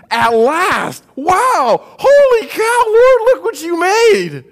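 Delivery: loud, high-pitched awestruck outburst; Adam hollering in amazed delight, stretching out the words; ecstatic exclamation. Acted. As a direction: whoop it out, overjoyed and hammed-up. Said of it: "Adam exclaimed".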